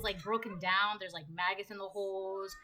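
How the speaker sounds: ordinary delivery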